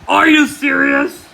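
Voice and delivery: normal talking voice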